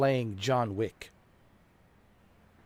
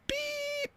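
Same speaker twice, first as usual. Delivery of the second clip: very high sung censor beep; 6 dB quieter than usual. Pretend. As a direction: bleep out the word yourself. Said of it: "I didn't finish the sentence".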